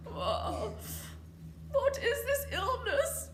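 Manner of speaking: high, strained sick-king croak. Acted. Sick king croaking to his doctors. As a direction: croak it out, poorly. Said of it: "He croaked".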